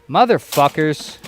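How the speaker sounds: normal talking voice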